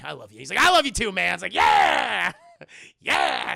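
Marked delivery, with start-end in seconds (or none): 0.0-0.4 low, soft acted declaration; understated imitated affection; calm affection. Calm take before shouted answer. 0.6-1.3 hammed-up loud affection; exuberant reply. Shouted answer to friend. 1.5-3.5 loud whooping cheer with a laugh; mock cheer. Mock celebration of friends.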